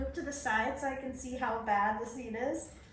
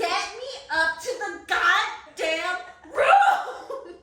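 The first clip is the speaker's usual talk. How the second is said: slow laughing demand; 5 semitones higher, 6 dB louder, much slower than usual. Retold or imitated.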